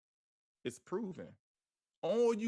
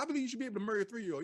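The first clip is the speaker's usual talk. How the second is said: rattled off very fast in one breath; real fast; 5 semitones higher, 3 dB louder, much faster than usual. Shown as a demonstration. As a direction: blurt the whole line fast so nobody catches it. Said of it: "They say it real fast".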